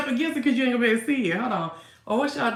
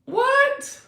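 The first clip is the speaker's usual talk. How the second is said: high, loud surprised outcry; sudden squeal of surprise; 6 dB louder, much slower than usual. Real, not acted. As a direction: shriek in delighted disbelief. Surprised reaction to comment.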